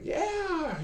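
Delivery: small son answering dad with glee; kid's eager yeah. Retold or imitated. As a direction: chirp it like a small excited kid. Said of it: "And they're like".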